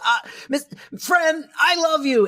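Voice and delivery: mock-romantic gush; hammed-up confession. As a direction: ham up the lovestruck boss. Retelling Sheffield's dramatic confession.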